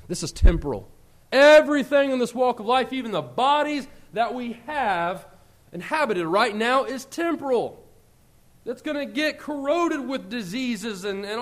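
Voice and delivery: rising preacher's emphasis; preaching. Acted. Preacher stressing to the congregation, emphatic. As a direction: drive home the point from the pulpit.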